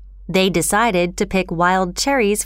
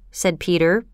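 usual speaking voice

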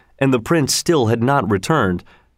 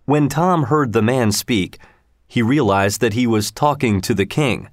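usual speaking voice

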